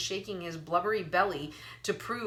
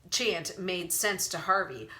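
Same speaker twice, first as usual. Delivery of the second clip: ordinary delivery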